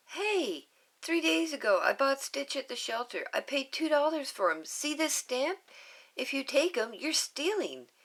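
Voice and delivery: put-on girl defending her pet to aliens; indignant little girl. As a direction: argue your case proudly. Lilu defends ownership.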